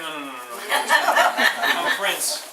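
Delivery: loud, slow, laughing no-no-no; hammed-up spoiled prince refusing, laughing; mock-outraged prince laughing it off; laughing prince refusal. Prince voiced refusing death, laughing.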